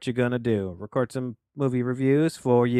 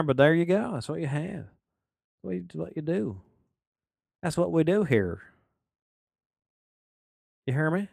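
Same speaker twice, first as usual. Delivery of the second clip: higher, playful hint of a Southern accent; mock Southern accent; 7 semitones higher than usual. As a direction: keep a light Southern twang going. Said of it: "I don't know why I went Southern there".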